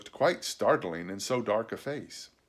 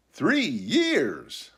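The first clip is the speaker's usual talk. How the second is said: higher-pitched exclamation of disbelief; astonished cry; 6 semitones higher than usual. A narrator's reading. Boy hearing how long the castaway was alone, astonished. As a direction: cry it out in amazement. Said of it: "I cried".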